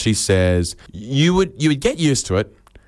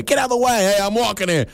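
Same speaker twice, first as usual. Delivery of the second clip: much higher, mock New York street yell; rude pedestrian; 12 semitones higher, faster than usual. Pedestrians yelling at a man on the street, high mock yell. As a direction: snap at someone blocking the sidewalk.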